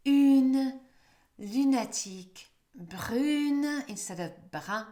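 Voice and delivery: "une" and "brune" said loud with a clear u vowel; French u before vowel. Shown as a demonstration. Teacher contrasting "brune" with "brun". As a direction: punch the u sound in "une". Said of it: "it changes to U".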